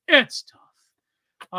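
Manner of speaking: hushed, breathy whisper; whispered aside. Real, not acted. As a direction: repeat it under your breath in a whisper. Repeats same words in a whisper.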